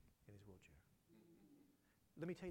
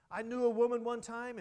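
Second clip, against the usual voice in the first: preaching at full force; 8 semitones higher, 14 dB louder than usual. Acted. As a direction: drive the promise home to the congregation.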